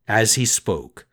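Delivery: usual speaking voice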